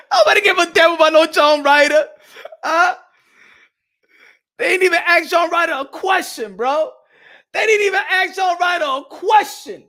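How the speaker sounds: loud, high, exasperated ranting. Real, not acted. Vlogger loudly rants that nobody cares about Ryder. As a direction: hammer the point home loudly.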